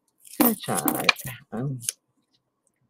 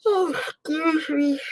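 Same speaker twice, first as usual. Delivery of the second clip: slow, higher words pulled through a yawn. Real, not acted. Apologising to her viewers, talking through a yawn. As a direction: stretch it out mid-yawn. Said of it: "I'm yawning".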